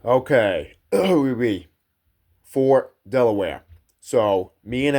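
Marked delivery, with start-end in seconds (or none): none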